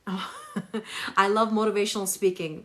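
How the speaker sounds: normal talking voice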